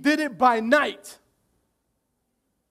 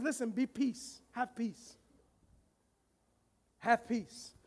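much softer, gentle and calm; the Lord calming a frightened Gideon, softly; soothing divine voice; 4 semitones lower, 13 dB quieter, slower than usual. Acted. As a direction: hush him into peace.

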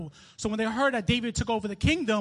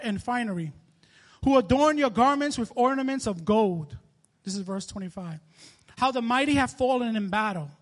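scripture reading; 2 semitones higher, 3 dB louder than usual. Acted. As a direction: give the lament its full grief. Reads the lament aloud.

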